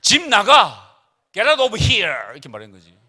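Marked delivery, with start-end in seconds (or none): 0.0-1.0 loud, higher furious yell at the son; retold mother shouting at her son; angry shout. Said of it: "내 아내가 우리 아들한테 이렇게 얘기했지". 1.3-2.3 "Get out of here!" yelled loud and high, slow, with "out" hit hardest; "Get out of here!" as a furious mother's yell; angry shout. Retold or imitated. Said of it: "내 아내가 우리 아들한테 이렇게 얘기했지".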